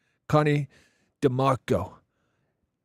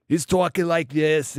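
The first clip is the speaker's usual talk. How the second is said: louder, higher mock Boston accent; 6 semitones higher, 6 dB louder than usual. Streamer imitating a guy he met abroad, Boston accent. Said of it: "a proper Boston accent".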